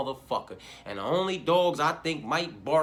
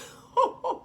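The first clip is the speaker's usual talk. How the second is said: high laugh; 6 dB louder than usual. Real, not acted. Bursts out laughing at himself.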